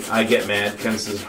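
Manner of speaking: ordinary talk, nothing marked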